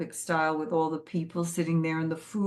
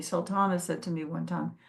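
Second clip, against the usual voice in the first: usual speaking voice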